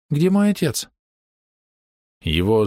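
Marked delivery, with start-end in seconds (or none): 0.1-0.9 put-on child voice. A narrator's reading. The little girl asks for father.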